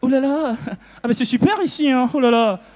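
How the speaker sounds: mock Parisian returnee; mock Parisian accent. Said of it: "quand il revient, c'est".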